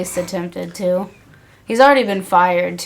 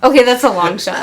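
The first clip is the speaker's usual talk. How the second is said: laughing; 4 semitones higher, 7 dB louder than usual. Real, not acted. Joking about marrying the wrestler.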